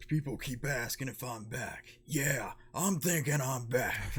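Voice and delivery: lightly acted movie hero line, slowing down; slow, emphatic movie-quote delivery; quoted movie line. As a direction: deliver a drawn-out movie catchphrase, breaking into a laugh. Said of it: "that was a part where".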